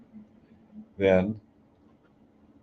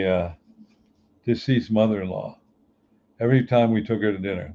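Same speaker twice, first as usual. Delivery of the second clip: usual speaking voice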